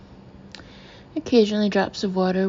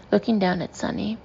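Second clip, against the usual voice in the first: usual speaking voice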